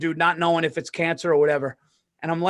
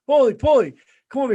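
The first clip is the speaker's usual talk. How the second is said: louder, higher call to come over; 6 semitones higher, 6 dB louder, faster than usual. Retold or imitated. Old roommate calling Paulie back from the bathroom, louder. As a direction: beckon him over eagerly.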